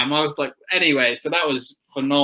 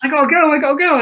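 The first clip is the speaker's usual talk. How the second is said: mock frantic, higher and louder; urgent; 12 semitones higher, 5 dB louder, faster than usual. Rider retelling his panic to get the bike for Skegness, higher and louder. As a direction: insist you have to leave, rushing.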